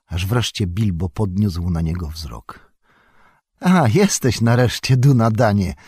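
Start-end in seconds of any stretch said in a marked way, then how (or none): 3.6-5.7 louder, raised greeting call. A narrator's reading. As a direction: hail a friend who has finally turned up. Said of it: "zawołał". (głośne, ożywione zawołanie)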